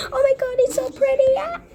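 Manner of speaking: high, loud, fast gush; excited squeal. Acted. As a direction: squeal with delight. Excited reaction to the room.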